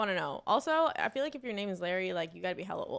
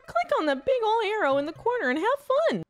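high-pitched cartoon tutorial character; higher, hammed-up character voice; game guide; 12 semitones higher, 5 dB louder than usual. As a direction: play the goofy guide, pitched up.

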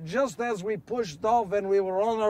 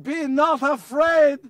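slow, high calm reassurance; voice of Jesus; 6 semitones higher, 7 dB louder, slower than usual. Acted. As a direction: speak slowly to calm frightened men. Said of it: "The voice said".

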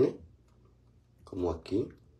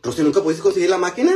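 excited question; 10 semitones higher, 8 dB louder, faster than usual. Real, not acted. Reading viewer comment excitedly.

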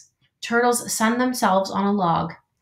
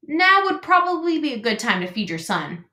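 higher goose, offhand and chatty; breezy old goose; 6 semitones higher than usual. A narrator's reading. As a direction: brush it off like a know-it-all goose. Goose advising.

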